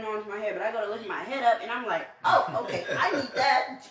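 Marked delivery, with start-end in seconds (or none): 2.2-3.9 mock surprise. Retells getting hair grabbed.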